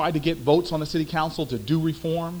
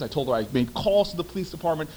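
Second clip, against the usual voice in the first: ordinary talk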